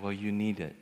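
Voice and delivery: soft, low imitation of a giving mom; subdued mother. Mom hands child hard-earned money, soft low voice. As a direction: say it quietly and gently, pitched low. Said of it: "put it in my hand and said".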